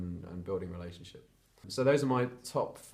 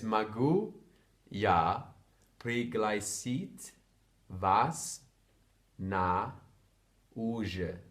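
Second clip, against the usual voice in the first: slow and louder, each word split by pauses; 3 dB louder, much slower than usual. Shown as a demonstration. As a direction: spell it out one word at a time for a learner.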